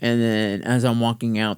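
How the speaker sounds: normal talking voice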